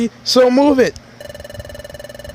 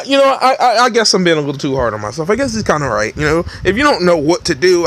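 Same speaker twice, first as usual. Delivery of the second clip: ordinary delivery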